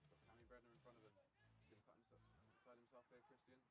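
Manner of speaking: live football commentary; play-by-play commentary. Acted. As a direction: call the match as it happens.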